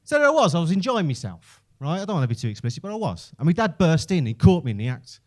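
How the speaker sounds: normal talking voice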